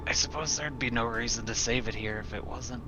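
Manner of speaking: normal talking voice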